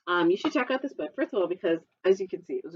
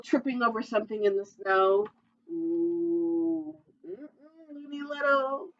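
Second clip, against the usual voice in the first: ordinary delivery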